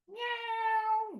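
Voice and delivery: playful kitty cry for the class; high cat meow. Shown as a demonstration. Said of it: "find the cat tail".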